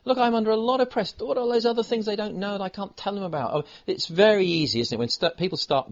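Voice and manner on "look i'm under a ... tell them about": self-pitying husband. Retold or imitated. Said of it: "you tell your wife".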